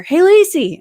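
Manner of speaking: high, loud call across a room. Retold or imitated. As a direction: hail a friend from a distance. Friend calling her across stadium.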